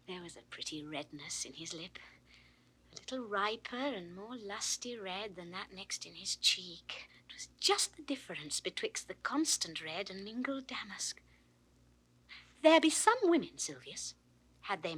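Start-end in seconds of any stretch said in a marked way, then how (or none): 0.1-11.2 soft, breathy whisper. Acted. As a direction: hush it, lost in a daydream. Phoebe dreamily recalling the youth.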